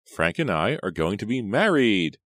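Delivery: high, loud, joyful announcement; excited cry. A narrator's reading. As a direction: build up to the big news. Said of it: "cried Kate".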